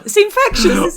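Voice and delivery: laughing. Real, not acted. Laughing at own silly chant.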